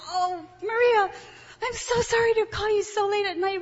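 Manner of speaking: high, near-tearful apology; apologetic. Retold or imitated. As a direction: plead sorry for the late call. Apologizing for a late call.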